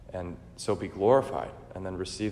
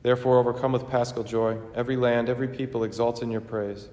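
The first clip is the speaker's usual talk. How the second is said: raised, ringing liturgical chant-speech; solemn preface; 4 semitones higher, 5 dB louder than usual. Acted. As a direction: proclaim it to the whole church. Sung-speech preface, raised and louder.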